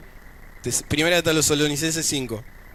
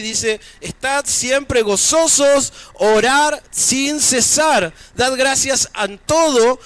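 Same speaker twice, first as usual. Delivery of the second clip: higher-pitched, projected sermon delivery; preaching; 7 semitones higher, 4 dB louder than usual. Acted. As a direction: preach it in measured, emphatic phrases with pauses. Resumes preaching after an aside.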